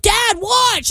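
put-on high excited kid calling to his dad; high-pitched child yelling for attention; bratty kid. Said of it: "He's like".

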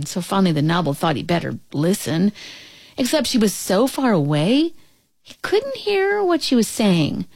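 ordinary delivery

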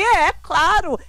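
high, indignant retold comeback; deeply offended. Daughter retells answering her mother on mourning, deeply offended. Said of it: "eu fiquei ofendidíssima".

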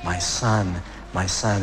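low, understated grieving repetition; retold crying. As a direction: lightly imitate a grieving mother, pitched low. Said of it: "kept crying over and over again".

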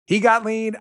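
high, loud "He got lean"; excited repeat. Shown as a demonstration. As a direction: hype it up the second time. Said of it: "You know what he did".

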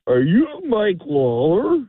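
put-on high, slow, drawn-out drunk question; drunk young man. Drunk at the bar asks a stranger who he is, mock drunken voice. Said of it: "fully inebriated kid".

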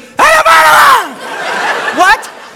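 high shrieking laughter with garbled words; hammed-up laughing fit; breathless hysterical laughing imitation. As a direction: try to talk through helpless laughter. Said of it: "but she can't breathe".

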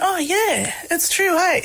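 high, delighted "oh, yeah" of getting it; a light bulb moment, voiced high and bright; realisation. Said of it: "light bulb moment went off".

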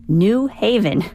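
"New Haven" with the weight on New; questioning NEW Haven; stress on NEW. Shown as a demonstration. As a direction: punch New in "New Haven", letting Haven fall away. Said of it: "with the emphasis on new".

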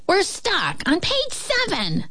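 high-pitched storybook piglet, lightly voiced; first pig. A narrator's reading. First pig reports they are stuck in the book, light high voice. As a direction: announce it high, as a little pig. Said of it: "said the first pig".

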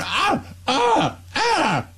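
high mock cries of pain; hammed-up yelps of fake agony; mock pained groans. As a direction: ham up a player writhing on the ground. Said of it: "writhing around".

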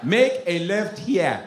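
put-on drawn-out direction in a Nigerian accent; African GPS. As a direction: navigation voice with a thick African accent. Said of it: "I have African G P S".

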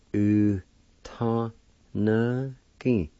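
u, ta, na, gi said apart; syllable by syllable. Shown as a demonstration. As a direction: separate u ta na gi. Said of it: "Second part".

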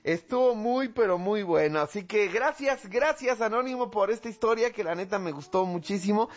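high, delighted radio host; enthusiastic thanks. Real, not acted. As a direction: gush with high-pitched appreciation. Host enthusiastically thanks story sender.

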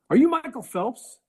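a starstruck fan blurting out a wrong guess; excited customer voice. Retold or imitated. Customer wrongly identifying Eli, high eager fan voice.

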